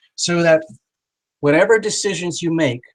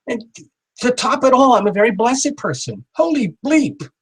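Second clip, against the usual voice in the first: much higher, building to the big finish; mock surprised climax; 9 semitones higher than usual. Shown as a demonstration. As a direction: top it off with amazed delight. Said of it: "And then I kinda go in surprise".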